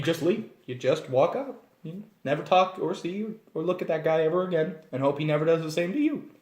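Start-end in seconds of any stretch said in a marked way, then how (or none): none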